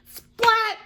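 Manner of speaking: high, sudden exclaimed splat. Acted. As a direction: burst out the sound effect. Sound effect in story.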